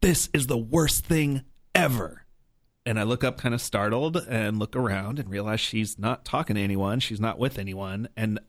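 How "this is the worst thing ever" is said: retold angry outburst. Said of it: "really loud and, like, angry".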